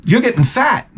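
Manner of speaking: lightly voiced child teasing; kid voice. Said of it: "he goes".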